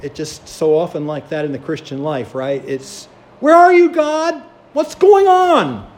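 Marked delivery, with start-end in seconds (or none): 3.4-5.9 high, loud anguished cry, slowing on the last words; desperate plea. Retold or imitated. Frightened believer crying out to God, high and loud. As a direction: wail it like someone lost in the storm.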